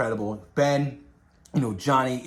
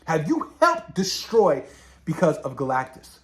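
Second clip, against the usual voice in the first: pleading accusation; 6 semitones higher than usual. Retold or imitated. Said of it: "He was like".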